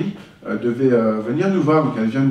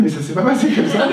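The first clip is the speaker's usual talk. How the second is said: higher-pitched, laughing retold protest; amused father; 6 semitones higher than usual. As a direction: imitate an amused dad objecting through a laugh. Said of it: "ça l'a fait rigoler aussi".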